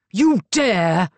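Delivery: loud furious outburst; thundering. A narrator's reading. Master of the house thunders at Rosalind, furious shout. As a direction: thunder it at the intruder. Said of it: "thundered Marcus".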